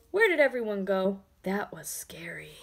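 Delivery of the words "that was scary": unvoiced shaken whisper; frightened whisper. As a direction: breathe it, still spooked. Letter shaken after scare.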